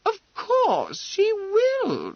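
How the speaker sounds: very high, loud, drawn-out insistence; emphatic assurance. Acted. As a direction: stretch it out imperiously over all objection. Overbearing mother cuts in for daughter.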